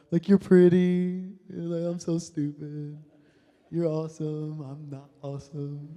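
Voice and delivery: slow, dopey lovestruck mumbling; lovestruck daze. Retold or imitated. Smitten guy zoned out as the girl talks, lovestruck daze. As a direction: play a smitten guy, dragging the words out.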